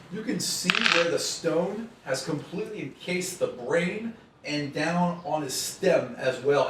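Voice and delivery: usual speaking voice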